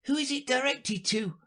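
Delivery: very high, unforced question; high-pitched juror voice; juryman. Acted. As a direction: ask as a little creature on the jury. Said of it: "said one of the jurymen".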